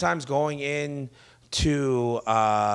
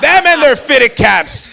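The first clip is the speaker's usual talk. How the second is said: slow, high hammed-up shout; drawn-out comic yell; mock ranting; 11 semitones higher, 13 dB louder, much slower than usual. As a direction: stretch out a loud parody gripe. Continues the mock racist routine.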